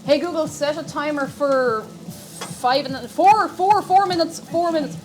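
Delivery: slow, loud, high voice-assistant call; loud command to speaker. Real, not acted. Calling loudly to the smart speaker for a timer. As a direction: spell it out for the machine. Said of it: "Five minutes".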